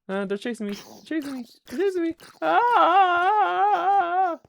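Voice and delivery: rising panic into a scream; panicked high-pitched yelling. Real, not acted. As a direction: squeal in terror as they chase. Chased by monsters in game.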